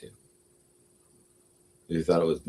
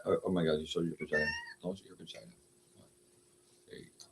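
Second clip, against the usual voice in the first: softer and softer teasing; fading mock scold; 10 dB quieter, faster than usual. Real, not acted. Teasing the cat Lola, trailing off quietly. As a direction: let it drop to almost nothing.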